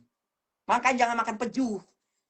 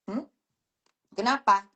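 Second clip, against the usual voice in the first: usual speaking voice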